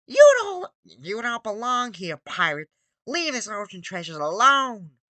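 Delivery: high, loud, slow cartoon sponge shouting at a pirate; SpongeBob voice.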